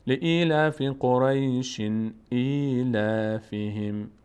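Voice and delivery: "لإیلاف قریش ایلافهم" slow, with -in sounded after قریش; joined recitation. Shown as a demonstration. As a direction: recite "لإیلاف قریش ایلافهم" onward, long vowels stretched and tanwin n clear. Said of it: "اگر بخوایم ادامه بدیم".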